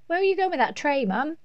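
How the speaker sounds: put-on high-pitched little girl asking Mum; child's questioning. A narrator's reading. Laura asks Mum where she is taking the tray, high and curious. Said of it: "asked Laura".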